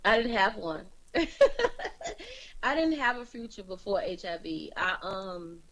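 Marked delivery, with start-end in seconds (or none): 1.1-2.3 short high-pitched laugh. Real, not acted. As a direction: laugh at your own blunt answer. Guest laughs after her answer.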